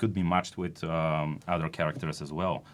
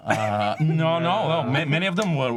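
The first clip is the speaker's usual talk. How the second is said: excited; 8 semitones higher, 6 dB louder than usual. Real, not acted. Laughing denial among friends.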